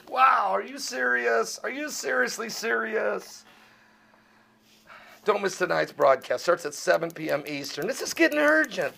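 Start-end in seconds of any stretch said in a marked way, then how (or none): 0.1-3.4 incredulous. Real, not acted. Incredulous reaction. 7.9-9.0 high, pressing warning; urgent warning. Real, not acted. Escalating warning.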